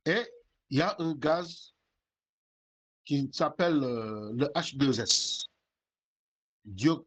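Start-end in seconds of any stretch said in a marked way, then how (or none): none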